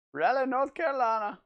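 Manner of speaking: lightly voiced Southern country accent; a touch of Southern country accent. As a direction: say Raleigh with a mild country twang. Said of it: "my country three D accent".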